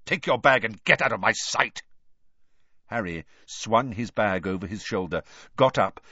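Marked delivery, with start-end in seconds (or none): 0.0-1.8 louder, irritated order; fed-up teacher's sharp command; angry dismissal. A narrator's reading. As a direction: send the pupil out with contempt. Snape angrily dismisses Potter.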